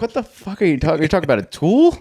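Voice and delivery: high, lightly put-on confused voice; mock baffled Spaniard. Imitating a Spaniard hearing the slang, mock confusion. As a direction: play someone in Spain lost by the slang. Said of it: "they're like".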